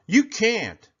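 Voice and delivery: high, loud bully yell. A narrator's reading. Bully Sammy yelling at Kevin. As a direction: snap it at him. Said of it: "he yelled".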